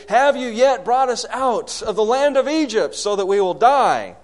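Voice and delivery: loud, high protest to Moses; acted angry complaint. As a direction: hurl the accusation as an aggrieved crowd. Acts Israelites' angry complaint to Moses.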